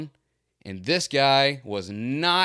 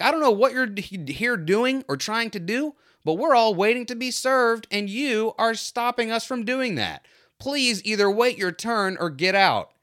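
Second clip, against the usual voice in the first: annoyed customer; 11 semitones higher than usual. Retold or imitated. Customers telling the shop owner to wait his turn, mock indignant scolding. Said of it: "They were like".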